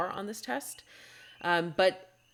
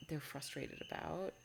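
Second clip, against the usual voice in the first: usual speaking voice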